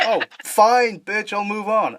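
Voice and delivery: high, exasperated put-on retort; mock huff. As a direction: act huffily rejected, starting loud. Acts out a rejected suitor's huff.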